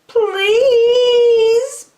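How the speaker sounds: very high, loud whiny please; high begging bird. Acted. Lazy bird begging the elephant, high and whiny. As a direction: beg shamelessly, stretched out. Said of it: "begged the bird".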